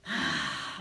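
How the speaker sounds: long, audible breath; big breath. Acted. Animals breathe with spider before the launch, big breath. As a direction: breathe deeply as if gathering force. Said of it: "they all breathe with her".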